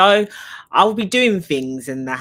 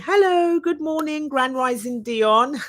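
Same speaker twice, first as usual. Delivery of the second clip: much higher, bright sing-song hello, slowing at the end; cheery greeting; 11 semitones higher, 4 dB louder than usual. Shown as a demonstration. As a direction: greet the viewer with a big lifted voice. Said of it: "I'm gonna say".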